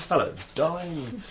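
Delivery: light vintage movie-star purr; a playful classic Hollywood leading man; Cary Grant. Pretend. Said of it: "I could try and do my best Cary Grant".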